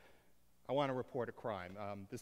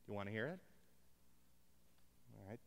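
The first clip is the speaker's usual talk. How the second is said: ordinary talk, nothing marked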